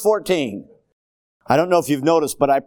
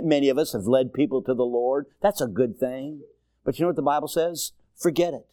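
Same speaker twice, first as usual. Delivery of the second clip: ordinary talk, nothing marked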